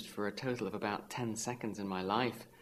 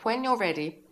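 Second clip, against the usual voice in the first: high, loud, snooty director's voice; hoity-toity; 11 semitones higher, 8 dB louder than usual. A narrator's reading. Director to actors from the back of the auditorium, hoity-toity. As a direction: call out like a haughty grande dame. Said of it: "she says in this hoity-toity voice".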